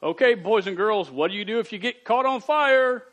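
hammed-up fire safety visitor; Fireman Bob voice. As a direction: play the cheerful fireman addressing kids. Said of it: "Fireman Bob ... would come to your school and go".

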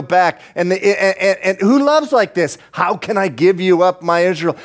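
high-pitched, urgent sermon climax; impassioned preaching. Real, not acted. Preacher marvels who loves like this, fervent.

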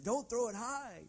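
high, loud frightened plea; a small boy begging dad not to throw him; scared little son. Retold or imitated. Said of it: "he's like".

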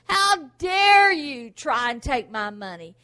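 slow and loud, hitting dare; loud slow How dare you; defiant rebuke. Pretend. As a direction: challenge the thief. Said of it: "when the devil comes to attack you".

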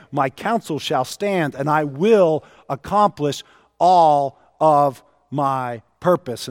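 usual speaking voice

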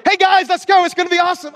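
same high yell, now pretending it's fun; hollering, fake cheerful. Dad covering for church onlookers, hollering cheerfully at the kids. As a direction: keep hollering but make it sound upbeat. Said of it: "'cause I don't wanna change my tone".

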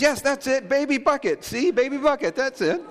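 put-on high confident girl voice; child voice. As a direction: insist like a sure small child. Voicing daughter insisting.

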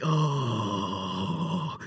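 low, drawn-out "oh"; acted groan sinking down in pitch; long groan. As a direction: softly let out a long, low moan. His reaction once Josh is free.

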